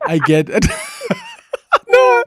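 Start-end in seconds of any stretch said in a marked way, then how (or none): 0.7-1.8 high whooping laugh. Real, not acted. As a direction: let out a real shrieking laugh. Host bursts out laughing at the example.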